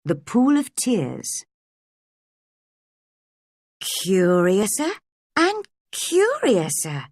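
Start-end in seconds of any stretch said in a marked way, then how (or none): 3.8-7.1 rising astonished wonder, stretched out slowly; amazed Alice. Acted. Alice marvels at growing.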